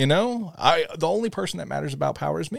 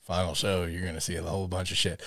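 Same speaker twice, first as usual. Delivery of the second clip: quoted threat; 7 semitones lower, 10 dB quieter than usual. Retold or imitated. Acts out character's quiet threat.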